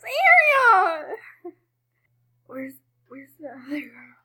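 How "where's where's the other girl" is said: hushed searching whisper. Real, not acted. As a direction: whisper while sneaking. Sneaking in horror game.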